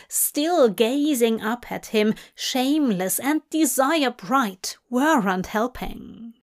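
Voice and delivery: ordinary delivery, nothing marked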